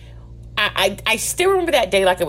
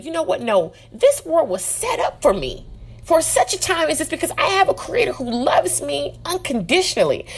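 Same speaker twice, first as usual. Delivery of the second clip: higher-pitched, emphatic declaration of faith; acted-out self-affirmation, pitched up; confident self-talk; 8 semitones higher than usual. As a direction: declare it as an assured believer. Voicing a believer's confident self-talk.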